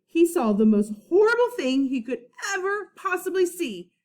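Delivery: ordinary talk, nothing marked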